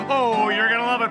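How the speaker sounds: ordinary delivery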